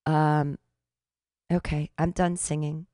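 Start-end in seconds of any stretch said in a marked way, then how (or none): none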